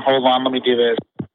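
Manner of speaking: normal talking voice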